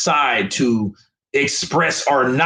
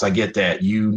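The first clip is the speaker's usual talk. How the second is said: ordinary talk, nothing marked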